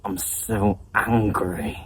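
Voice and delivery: low, slow seething; acted anger. Teacher acting out what fuming means, low angry delivery. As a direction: drag it out low, as if barely containing anger. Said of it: "That was pretty good acting".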